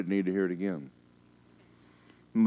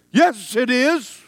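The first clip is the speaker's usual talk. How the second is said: high, loud, slow mocking sneer; shrieking demonic taunt; 11 dB louder, much slower than usual. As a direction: jeer it like a spiteful demon.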